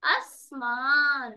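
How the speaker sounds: आसमान stretched very slowly, with the nasal sound dropped; slow teaching demo of आसमान without the nasal; Dakhni pronunciation. Shown as a demonstration. Teacher drills students on the Dakhni आसमान, slowly. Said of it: "इसको क्या बना देते हैं".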